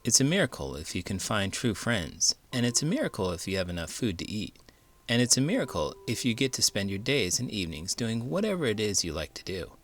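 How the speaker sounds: plain reading voice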